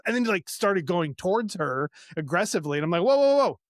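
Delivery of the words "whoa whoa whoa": louder and higher, stop-it warning; alarmed whoa. Retold or imitated. Stopping the father-in-law from going at his wife, an alarmed loud whoa. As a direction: halt a man lunging forward. Stepping in as he goes at her.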